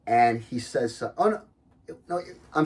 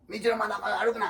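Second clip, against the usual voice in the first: quick scolding; 10 semitones higher, much faster than usual. Retold or imitated. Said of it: "he's like".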